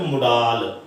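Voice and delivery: ਮੁਡਾਲ said moo-DAAL; common mispronunciation. Retold or imitated. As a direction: imitate the mistake ਮੁਡਾਲ, long 'aa' at the end. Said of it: "ਕੁਛ ਲੋਕ ਜੋ ਹੈ". (ਮੁਡਾਲ, ਗਲਤ ਉਚਾਰਨ ਦੀ ਨਕਲ, ਲੰਮਾ 'ਆ')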